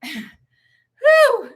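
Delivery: breathless laugh after a whew; laughing exhale. Real, not acted. Laughing after the pep talk.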